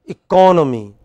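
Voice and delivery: loud, clear eCONomy for repetition; eCONomy as a class drill; stress on second syllable. Shown as a demonstration. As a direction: say "economy" punching CON. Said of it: "I will say it first".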